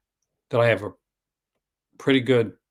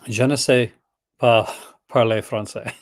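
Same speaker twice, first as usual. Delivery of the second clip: slightly louder spoken French; French phrase; 4 dB louder than usual. Shown as a demonstration. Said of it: "let me say".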